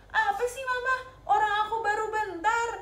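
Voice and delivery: put-on child whining at mama to leave him be; whiny child. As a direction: play the sulky kid who won't put the phone down. Child snapping back at mama.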